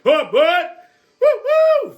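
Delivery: very high, loud woo-hoo; excited crazy train whoop. Acted. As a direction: whoop like a conductor. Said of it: "The crazy train's about to leave".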